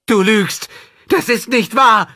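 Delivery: loud, high-pitched scream of accusation; schreiend. A narrator's reading. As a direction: scream it at full force in outrage. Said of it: "Dann schrie sie". (laut und schrill herausgeschrien)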